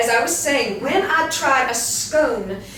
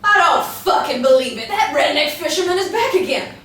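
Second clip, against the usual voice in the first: loud angry swearing; furious outburst; 5 semitones higher, 6 dB louder than usual. Acted. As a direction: spit it out in disgust. Furious at returning fisherman.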